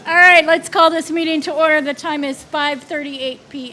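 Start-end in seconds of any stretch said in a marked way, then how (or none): 0.1-1.8 loud teacher calling the room to order. Real, not acted. Board chair to rowdy staff, loud teacher voice. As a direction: project it like a teacher quieting a class. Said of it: "Don't let me get my teacher voice on".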